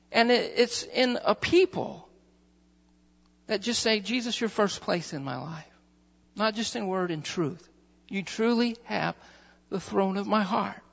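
hushed sincere devotion. Real, not acted. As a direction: drop far down to a soft, heartfelt hush. Intimate closing devotion.

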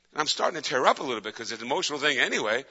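normal talking voice

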